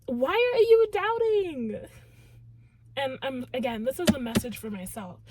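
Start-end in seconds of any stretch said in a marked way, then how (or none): 0.1-1.9 loud, high, drawn-out reproach; exasperated challenge. Acted. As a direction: challenge the doubter, stretching the words slowly. Acted rebuke of the doubters.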